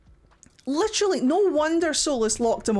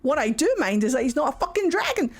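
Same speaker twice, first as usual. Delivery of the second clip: ordinary delivery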